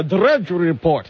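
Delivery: slow "Drudge Report" with long rolled R's. Shown as a demonstration. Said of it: "roll the R's".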